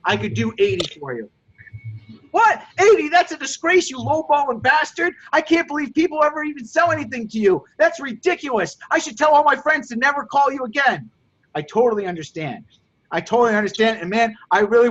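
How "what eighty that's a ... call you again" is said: furious customer. Acted. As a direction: blow up at the insulting offer. Role-played furious customer.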